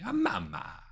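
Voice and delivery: high-pitched mock-Italian cry; sing-song cartoon Italian exclamation; Italian accent. Player joking about the tattoo's accent, Italian accent. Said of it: "Probably had an accent".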